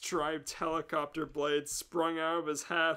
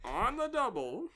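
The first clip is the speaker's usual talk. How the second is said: a lightly voiced bumbling inspector; eager inspector; 7 semitones higher than usual. The inspector demanding a mission in a put-on voice.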